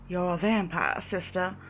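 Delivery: softly spoken Southern preacher; Southern drawl. Acted. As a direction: welcome them in a quiet Southern drawl. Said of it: "aristocratic Southern drawl".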